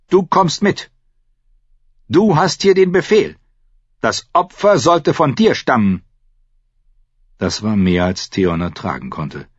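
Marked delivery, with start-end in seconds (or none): 0.1-6.0 high, loud insistent orders; commanding. A narrator's reading. Priest uncle orders nephew to join the sacrifice, commanding. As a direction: press the duty with a raised voice.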